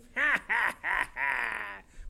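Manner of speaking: villainous staged cackle; drawn-out wicked laugh; Green Goblin. Acted. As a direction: cackle like a comic-book villain. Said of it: "Or, like, the Green Goblin".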